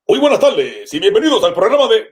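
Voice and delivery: high, loud formal show opening; Spanish TV presenter. Acted.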